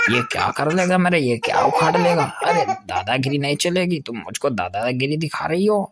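usual speaking voice

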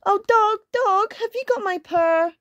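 high, pleading kitten voice; squeaky storybook kitten; little kitten. A narrator's reading. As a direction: ask it like a tiny anxious kitten. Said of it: "think of a voice for all of these characters".

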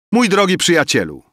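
high, loud ceremonial address; solemn. A narrator's reading. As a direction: address your friend with mock-grand ceremony. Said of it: "rzekł Emil uroczyście". (uroczyście, głośno i wysoko)

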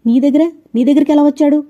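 high, loud panicked question; urgent, scared mother's cry; frightened, anxious. A narrator's reading. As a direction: blurt it out in alarm. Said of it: "బెదిరిపోతూ ఆతృతగా అడిగింది".

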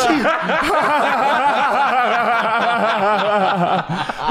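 high, squeaky laughing speech; laughing hard. Real, not acted. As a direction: talk through a fit of giggles. Cracking up at own joke.

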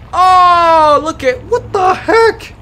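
high, drawn-out disbelief; astonished. Real, not acted. Player spots a green monkey, high astonished cry. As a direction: pitch it way up in amazement.

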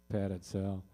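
usual speaking voice

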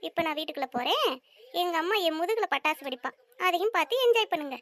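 ordinary talk

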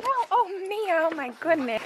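very high, cooing dog voice. Real, not acted. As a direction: gush in a squeaky pet-owner coo. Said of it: "baby talk your dogs".

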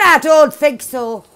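ordinary talk, nothing marked

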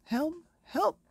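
high, loud panicked page; frantic cry for help. Acted. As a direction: shout it in alarm like a frightened page. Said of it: "cried the court".

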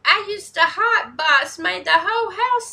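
high, loud whooping hype; loud, high-pitched excitement easing as it goes; hyped-up reaction. Real, not acted. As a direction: holler the bars back, thrilled. Hyped reaction to rap lyrics.

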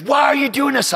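high-pitched mock scream; bewildered high yell; retold yelling. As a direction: imitate an injured man yelling in protest. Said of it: "yelling at me and screaming".

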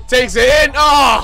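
high, loud roar on the shot; excited shout. Real, not acted. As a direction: burst out as it nearly goes in. Shot on goal, live commentary.

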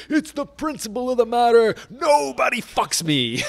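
high, loud impression of a furious cheapskate; outraged mock yell. Cheap partner defends fighting a phone bill, yelling in outrage. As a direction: yell it indignantly, stretching the last words.

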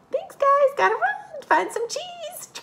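very high squeaky mouse, slowing at the end; a hurried little mouse dashing off; Cheddar the mouse puppet. Pretend. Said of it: "Cheddar, can you think of".

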